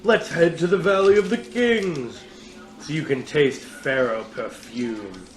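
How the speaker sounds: big booming movie trailer read; TV announcer voice. Acted. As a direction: sell it like a TV ad announcer. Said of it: "I think I'm just doing the T V announcer voice".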